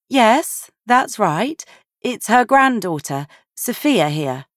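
a lightly voiced woman answering a call; Sophia on the phone. A narrator's reading.

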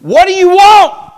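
long, high, loud acted yell; annoyed holler. Samuel bothered by Saul, exasperated holler. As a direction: bellow it like someone disturbed, stretching the words.

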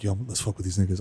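low conspiratorial. Retold or imitated. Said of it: "I was like".

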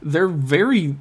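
ordinary delivery

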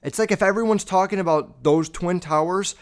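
ordinary talk, nothing marked

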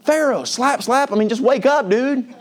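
very high-pitched, incredulous mock frustration; hammed-up exasperation, voice way up; exasperated. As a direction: scold him as if slapping him awake. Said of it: "I know if I was him, I'd be like".

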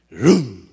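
loud low engine rumble; imitated engine noise. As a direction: rev like a stuck car. Car trying to start.

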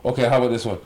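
usual speaking voice

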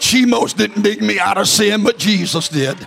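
rhythmic preacher refrain; preaching. Acted. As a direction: build it, then land on Jesus.

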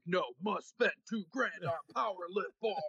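a hint of a caveman lifter; mild mock meathead. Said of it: "And they're like".

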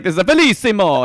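high, loud mock Italian. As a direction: gush in a cartoon Italian accent. Imitating host's Italian shtick.

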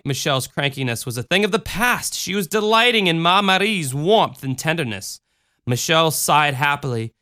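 ordinary delivery, nothing marked

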